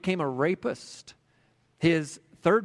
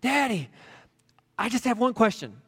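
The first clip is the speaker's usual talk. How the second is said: put-on higher, small child's voice, asking shyly; a shy kid working up the nerve to ask; a little boy timidly asking his dad a question; 8 semitones higher than usual. A narrator's reading. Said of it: "kind of a timid voice".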